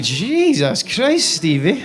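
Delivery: slow, drawn-out exasperated "Jesus Christ". Retold or imitated. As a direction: sbottare incredulo, lento. Exasperated reaction quoted.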